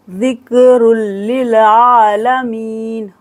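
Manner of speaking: slow, drawn-out tajweed chant; Quranic recitation. Shown as a demonstration. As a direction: recite it slowly. Recites verse. (धीमी तजवीद तिलावत)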